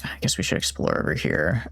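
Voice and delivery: hushed whisper. Real, not acted. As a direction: whisper it so nothing hears. Sneaking past enemies in game.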